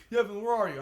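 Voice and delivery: retold impatience. Dinner party asking the late driver, mock impatient. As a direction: imitate friends chasing up a latecomer.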